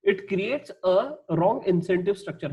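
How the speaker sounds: very slow, deliberate reading of the text; slow dictation; slow reading aloud. Shown as a demonstration. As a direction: read it out slowly for notes. Reading textbook slowly.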